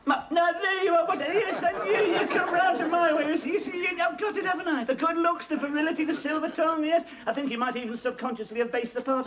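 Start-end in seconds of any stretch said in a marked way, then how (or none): 0.0-7.0 excited. Real, not acted. Rigsby thrilled at getting part.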